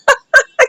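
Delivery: short high-pitched laugh; tickled giggle at the drama; amused laugh. Real, not acted. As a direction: break out laughing briefly. Amused at the hospital fight.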